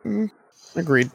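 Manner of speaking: ordinary delivery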